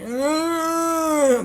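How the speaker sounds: very high "Ah"; mock gasp of recognition. Spotting the conspiracy columnist. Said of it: "It's like".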